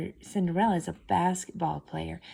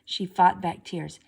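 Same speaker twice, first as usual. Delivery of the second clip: ordinary delivery, nothing marked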